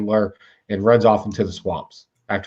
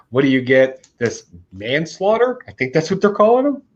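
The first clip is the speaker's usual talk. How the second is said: ordinary talk, nothing marked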